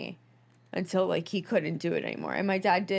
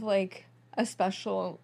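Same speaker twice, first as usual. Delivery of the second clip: normal talking voice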